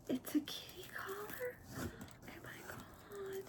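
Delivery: high, breathless whisper of awe; awed whisper. Real, not acted. Whispering in awe on finding a kitty collar. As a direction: barely breathe the words in wonder.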